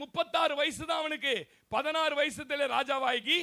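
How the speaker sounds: loud, slow, proclaiming the verse to the church; preaching at full force. Acted. Preacher proclaiming the king's age to his congregation, loud and slow. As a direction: thunder each phrase of scripture out slowly.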